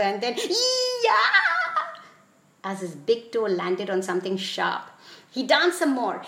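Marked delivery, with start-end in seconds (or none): 0.4-1.4 long, very high "ee-yah" shriek; pained yell. A narrator's reading. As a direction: yelp as if stepping on something sharp. Acted yell as toe hits something.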